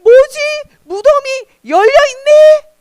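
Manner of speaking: high-pitched, loud shock; gasp, then cry out in astonishment; Mary's acted alarm at a shocking discovery; very startled. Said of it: "마리아는 너무너무 놀랐어요".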